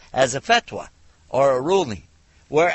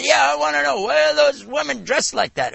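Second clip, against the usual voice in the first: higher and louder, pushy and blunt; harsh question; 12 semitones higher, 5 dB louder than usual. Retold or imitated. Woman repeating her challenge to the lecturer, harsh. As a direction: press the question again, hard. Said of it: "a very harsh question the way she brought it".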